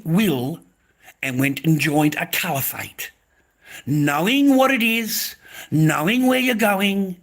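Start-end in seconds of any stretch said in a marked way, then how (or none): 3.9-7.2 loud, high, drawn-out reproach; angry reproach. Real, not acted. Man berating a woman who joined a caliphate, angry reproach. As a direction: hammer it home slowly, raised in pitch and volume.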